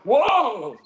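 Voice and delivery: loud, high startled cry; startled group reaction. Retold or imitated. Scared class reacting to sensei's outburst, startled cry. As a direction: recoil loudly as if scared. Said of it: "we all went".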